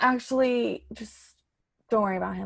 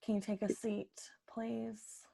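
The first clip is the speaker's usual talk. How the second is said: soft unvoiced request; whispered; 15 dB quieter, slower than usual. Boss quietly asking the inspector to sit down, whispered. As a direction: hush it like bad news is coming.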